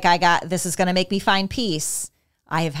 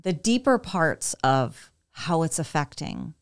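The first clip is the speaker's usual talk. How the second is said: ordinary talk, nothing marked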